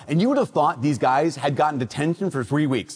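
ordinary talk, nothing marked

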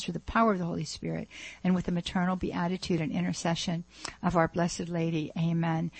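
closing a radio prayer with the Amen. Acted. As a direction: pray it aloud, sincere and hushed toward the end. Closes the spoken prayer.

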